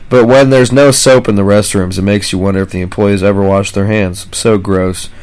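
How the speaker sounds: ordinary talk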